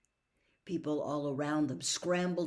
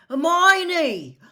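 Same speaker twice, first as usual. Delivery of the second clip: loud high alarmed call; alarmed cry; 12 semitones higher, 16 dB louder than usual. A narrator's reading. Ron seeing Hermione hit, loud alarmed cry. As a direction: cry her name in alarm.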